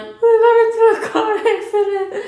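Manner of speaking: high, loud tearful laugh-cry; retelling the call to the boyfriend in a high, loud laughing sob; crying on the phone. Retold or imitated. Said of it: "Why are you crying".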